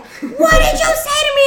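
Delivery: high-pitched mock indignation; shrill imitation of an offended teacher; outraged teacher. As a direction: leap up high in scandalized disbelief.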